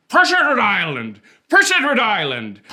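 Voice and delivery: "Prince Edward Island" run together, loud and high; proud loud islander cry of "Prince Edward Island"; mouth full of potatoes. Shown as a demonstration. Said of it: "like your mouth is full of russet Burbank potatoes".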